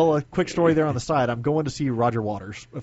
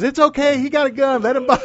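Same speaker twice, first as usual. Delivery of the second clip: loud, high-pitched imitation of a yell; retold yelling; 12 semitones higher, 9 dB louder than usual. Guard calling to a colleague down the security line, imitated loud yell. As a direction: mimic hollering down a long line of people. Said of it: "he starts yelling down the line".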